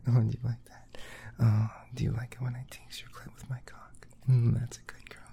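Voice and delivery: breathy intimate whisper, quick. Acted. To his partner, hungry whisper. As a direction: lean in and whisper the want.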